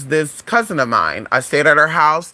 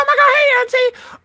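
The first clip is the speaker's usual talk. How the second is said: very high mock-excited hello; over-sweet greeting. Greeting auntie unexpectedly in the store, high squealed hello. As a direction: put on a squealing hello for a relative.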